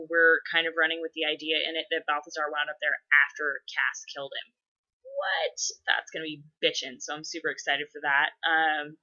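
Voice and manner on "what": high squeak of disbelief; shocked. Real, not acted. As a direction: jolt up in shock. Mock shock at Cass killing him.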